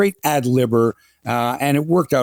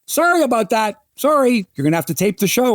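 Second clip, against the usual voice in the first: higher, breezy fake-sorry brush-off; mock apologetic host; 9 semitones higher than usual. Jim brushing off callers about the trivia answer, mock-sorry higher voice. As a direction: apologise without meaning it. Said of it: "And Jim would always say".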